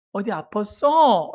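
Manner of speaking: put-on slow, drawn-out mom talking to her baby; mother's baby talk. Shown as a demonstration. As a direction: stretch it out tenderly, as if to an infant. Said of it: "이렇게 어른에게 말하는 말투가 아니죠".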